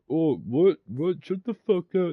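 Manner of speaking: high, loud jeering mock-outrage; mocking tough guy. Tyson taunting the speaker, imitated high and jeering. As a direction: sneer it in a squeaky mock-tough voice. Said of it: "He's like".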